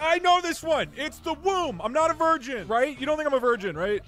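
high, eager bragging; smug excited. Acted. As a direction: show off like a kid who knows it. Smug overexcited answer.

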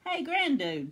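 high hailing shout; calling out. A narrator's reading. Cowboy waves his hat at Grandude, calling out. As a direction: hail a friend from afar. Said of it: "called the cowboy".

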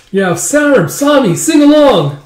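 high, loud joyful call; acted party cheer; excited calling. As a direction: call them to join in, drawing it out. Calling brothers to join singing.

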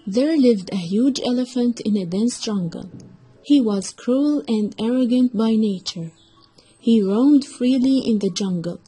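ordinary delivery

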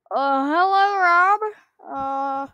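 ordinary delivery

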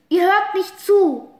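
loud, slow, accusing; girl accusing her friends; hurt shout. Acted. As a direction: throw it at them, hurt.

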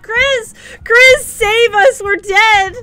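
high, loud, drawn-out plea; playful cry for help. Pretend. Player begging Chris to save them, high playful yelling. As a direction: call desperately to a teammate, half laughing. Calls teammate for rescue in game.

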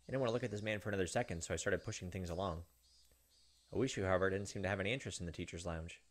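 reading the novel's text with quiet dread; tense story narration. Acted. Streamer reading Higurashi narration to viewers, tense narration. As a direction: narrate it like a creeping horror story.

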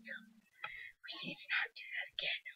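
very hushed, slow whisper; retold line in a soft whisper; whispered reply. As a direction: whisper it slowly, barely any voice. Retold reply, said under breath.

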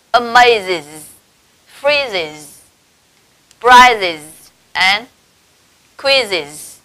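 "amazes, freezes, prizes and quizzes", each closing on a buzzing -iz; spaced-out model reading of "amazes, freezes, prizes and quizzes"; IS endings after z. Shown as a demonstration. Teacher modelling z-words for students, "amazes, freezes, prizes and quizzes" ending in -iz. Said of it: "chúng ta sẽ đọc thành".

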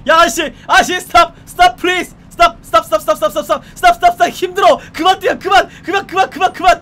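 loud, high, breathless yelling; frantic chase shouting. Pretend. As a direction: scream after a runner who won't stop. Cop chasing fleeing suspect. (도망자를 쫓으며 숨차게 외치는 고함)

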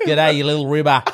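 Australian accent. Shown as a demonstration. Saying the set tongue twister for a friend, Australian accent. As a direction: ham up an Australian accent, laughing by the end. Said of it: "Australia and say".

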